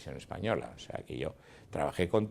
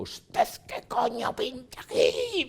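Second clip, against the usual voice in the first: high, strained, drawn-out mock shout; retold furious yell; 3 dB louder, slower than usual. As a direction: yell it like someone out to kill. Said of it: "a voz en grito".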